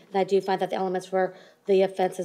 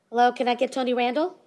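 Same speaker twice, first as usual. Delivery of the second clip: raised voice calling across the room; calling from far away; 3 semitones higher than usual. Real, not acted. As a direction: call out to be heard over a speaker. Judge calling to remote defendant.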